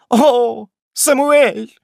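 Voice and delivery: high shocked woman's cry; exclaiming. A narrator's reading. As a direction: cry out in shrill dismay. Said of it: "воскликнула миссис Уэллер".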